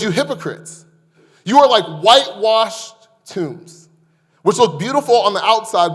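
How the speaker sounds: impassioned sermon rebuke; preaching at full force. Acted. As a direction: thunder the rebuke from the pulpit. Jesus's words preached loudly.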